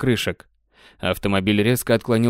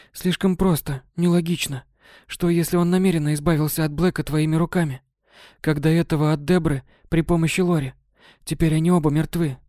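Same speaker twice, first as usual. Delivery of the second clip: higher, uneasy female voice; worried Kate; 5 semitones higher than usual. Acted. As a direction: voice Kate, anxious, pitched up. Kate voices her fear. (тревожный голос Кэтрин, выше)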